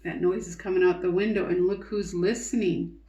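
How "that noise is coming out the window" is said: plain reading voice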